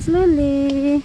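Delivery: loud, drawn-out warning. Real, not acted. Cautioning the child.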